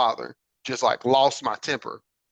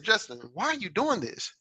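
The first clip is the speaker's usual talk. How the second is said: self-questioning; 6 semitones higher, 5 dB quieter than usual. Retold or imitated. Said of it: "I would ask myself".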